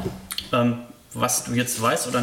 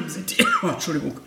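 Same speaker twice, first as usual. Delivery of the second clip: choking on a drink mid-sentence; coughing after swallowing the wrong way; swallowed wrong; 6 semitones higher than usual. Real, not acted. (verschluckt, hustend)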